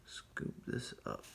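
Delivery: hushed, breathy guidance; whispered instruction. Real, not acted. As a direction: whisper so as not to distract. Quietly guiding student's stroke.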